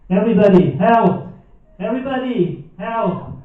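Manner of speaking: loud, high cries of help; panicked calls for help down a toilet hole; mock shouting for help. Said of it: "you rush around shouting".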